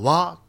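わ said as a short Aomori dialect "you"; Aomori dialect word. Shown as a demonstration. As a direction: clip わ short, the way people say it in Aomori. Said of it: "お前のことをわって言うんですよ". (青森弁の短い「わ」)

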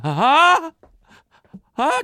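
high, stammering alarm; a servant who just spotted the boss; panicked servant. Acted. Servant warns everyone the master is back, high and panicked.

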